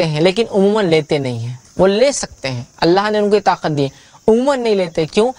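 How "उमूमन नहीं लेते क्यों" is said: normal talking voice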